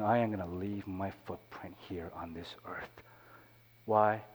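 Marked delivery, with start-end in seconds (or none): 0.0-3.0 whispered vow. Acted. Quoting a defiant vow.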